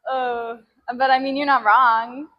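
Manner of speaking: ordinary talk, nothing marked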